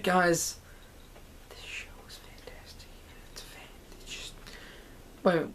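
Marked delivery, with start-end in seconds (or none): 1.5-4.3 hushed, breathy whisper of excitement. Real, not acted. Vlogger gushing to viewers about the show, whispered. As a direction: whisper it like a thrilling secret.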